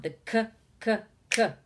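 "K" as a short, repeated kuh; isolated K sound. Shown as a demonstration. Teacher shows children the first sound, "K" as separate kuhs. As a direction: punch out each "K" as a separate kuh. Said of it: "begins with this sound".

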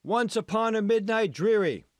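high, loud theatrical call across the room. A narrator's reading. A worker calls a movie line at Megan, loud and high. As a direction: call the line out to a passing star. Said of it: "noticed her and called out".

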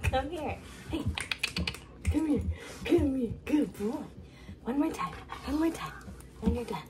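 high coaxing pet voice; calling a dog. Real, not acted. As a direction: call the dog in a bright high tone. Calling and praising dog during swab.

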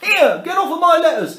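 high, loud frantic shout; panicked postman. Acted. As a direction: yell at the dog grabbing the letters. Postman chased by dog.

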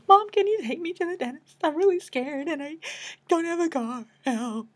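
high tearful plea, re-enacted; imitating a crying phone call; sobbing. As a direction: whimper it like a scared kid calling mom. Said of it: "I'm sobbing and crying".

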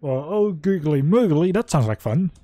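loud, gushing, high mock delight; excited. Real, not acted. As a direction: blurt it out all giddy. Viewer mocks the invitation.

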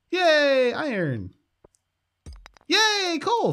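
high, delighted cheering; excited cheer. Real, not acted. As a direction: celebrate each find out loud. Real joy at wanted loot.

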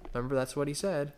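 usual speaking voice

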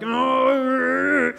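long high-pitched mock groan; frustrated groan. Preacher acting out inner panic over the setup, frustrated groan. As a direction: let out a drawn-out, high noise of exasperation. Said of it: "and all frustrated".

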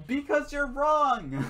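high, loud, drawn-out retort; real squabbling exasperation; exasperated retort. Real, not acted. Retort in heated argument.

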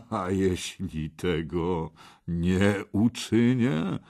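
slow, controlled question; calm menace. Acted. Red knight tests the boy, slow and calm. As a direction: ask it with unhurried calm. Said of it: "spokojnym głosem".

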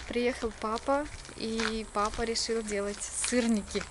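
ordinary talk, nothing marked

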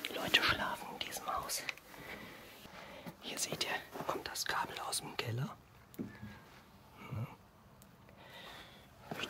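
hushed whisper so nobody wakes. Real, not acted. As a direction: whisper quietly, people asleep nearby. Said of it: "ich muss leise sein". (flüsternd)

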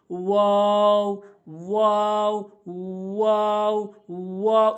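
drawn-out "ওয়াও" on a level tone; ওয়াও demonstration. Shown as a demonstration. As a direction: round into "ওয়াও" and hold it. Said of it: "এভাবে আমরা উচ্চারণ করব". ("ওয়াও", মুখের খালি জায়গা থেকে টেনে)

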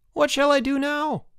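very high-pitched call down; calling boy. A narrator's reading. Stranded boy asking his rescuers for instructions, calling. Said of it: "he called".

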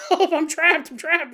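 high, loud panicked yell; mock scream. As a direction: scream it like you're stuck in bed. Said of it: "He can scream even louder".

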